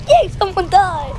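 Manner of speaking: mock cheer. Real, not acted. Cheering over a death in game.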